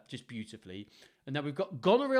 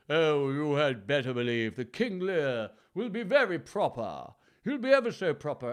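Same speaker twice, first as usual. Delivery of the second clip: loud, exaggerated royal English accent; 7 dB louder than usual. Shown as a demonstration. Reader demos how King Lear will sound, hammed-up royal accent. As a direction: put on a very proper royal accent. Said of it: "Like you are royalty".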